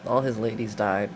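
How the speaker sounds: normal talking voice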